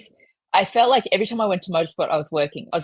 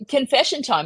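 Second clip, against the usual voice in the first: mock confession; 7 semitones higher, 3 dB louder than usual. Retelling her confession to manager.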